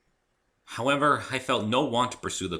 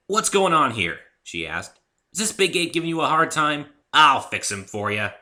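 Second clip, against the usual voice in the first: loud, high and suspicious; protective challenge; 8 semitones higher, 9 dB louder than usual. Acted. Rainbow Dash threatens the human, loud and protective. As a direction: square up to the stranger. Dash defends Pinkie from stranger.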